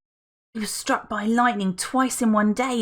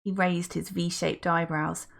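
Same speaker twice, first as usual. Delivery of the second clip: plain reading voice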